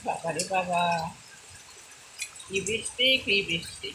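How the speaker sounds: ordinary talk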